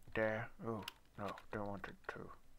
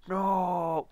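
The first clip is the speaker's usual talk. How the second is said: high, loud wail of despair; scream of dismay; 10 semitones higher, 15 dB louder than usual. Real, not acted. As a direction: scream it like everything is lost. Losing items in game.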